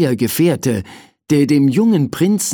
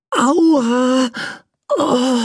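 a high, mildly hammed ouch; 11 semitones higher than usual. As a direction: wince as you wake up sore. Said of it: "rief der Prinz".

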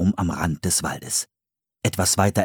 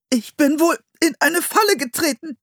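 gepresste Stimme. A narrator's reading. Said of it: "sagte er mit gepresster Stimme".

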